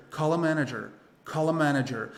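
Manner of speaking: "Call a" run together as "calla"; smooth linking in "Call a manager"; linked sounds. Shown as a demonstration. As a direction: say "Call a manager" as one joined phrase, L flowing into "a". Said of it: "ele vai se ligar com".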